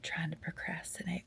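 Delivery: hushed whisper in a closet; whispering while hiding. Real, not acted. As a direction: whisper so he can't hear. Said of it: "I'm hiding in the closet so my husband doesn't find me".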